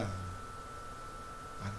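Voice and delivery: barely voiced, trailing echo; breathy echo. Preacher as God whispering a final assurance, barely voiced. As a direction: let it fade almost to nothing. Soft echoed "I know.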